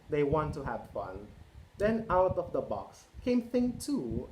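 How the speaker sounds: lively picture-book read-aloud; reading to children. A narrator's reading. Parent reading Cat in the Hat to children, lively. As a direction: read it out to a roomful of kids.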